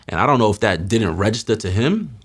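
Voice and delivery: ordinary talk, nothing marked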